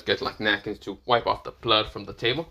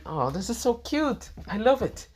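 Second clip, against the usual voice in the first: high, slow gushing voice; mother delighted by a statue; 11 semitones higher than usual. Acted. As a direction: coo over it like a delighted mom.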